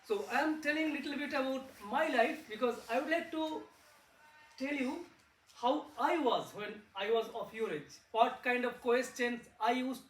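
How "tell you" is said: ordinary talk, nothing marked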